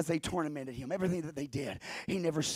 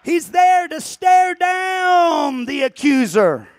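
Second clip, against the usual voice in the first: preaching at full force; 11 semitones higher, 21 dB louder than usual. Acted. Preacher shouting to the church at full force. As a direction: thunder it to the congregation.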